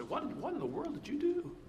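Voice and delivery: high, fast, disbelieving mother; scolding mom. Retold or imitated. Mother to her child after the kids got upset, high and disbelieving. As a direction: mom demanding what the kid did. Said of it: "and mom would say".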